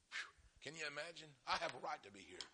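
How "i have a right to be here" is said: soft, low quoted claim; incredulous quote. Retold or imitated. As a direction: echo it quietly in disbelief. Voices presumptuous churchgoer in disbelief.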